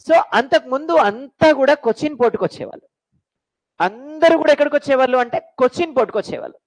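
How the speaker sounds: usual speaking voice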